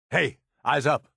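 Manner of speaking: normal talking voice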